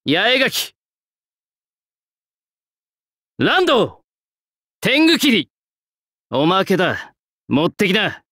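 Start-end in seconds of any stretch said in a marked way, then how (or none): 0.1-5.5 each move name called out higher and higher; rising battle call-outs; called-out technique names. Acted. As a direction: call out your sword techniques as you swing. Calling out sword technique names.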